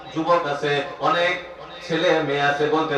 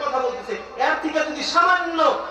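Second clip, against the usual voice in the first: preaching shout; 10 semitones higher than usual. Acted. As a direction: lift the voice sharply to show it.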